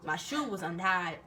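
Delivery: usual speaking voice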